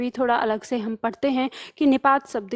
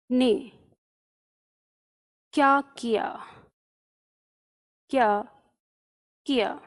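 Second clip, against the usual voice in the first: slow halting surprised read; mild slow wonder; slow drawn-out surprise; 2 semitones lower, 3 dB quieter than usual. Shown as a demonstration. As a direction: stretch it out, pausing between words. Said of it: "तो यहाँ पे आश्चर्य है".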